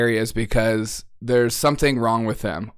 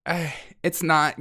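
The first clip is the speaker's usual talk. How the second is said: ordinary delivery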